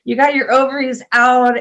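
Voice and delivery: high, loud startled exclamation; alarmed doctor. Retold or imitated. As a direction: imitate a doctor's loud sudden realization. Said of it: "she was like".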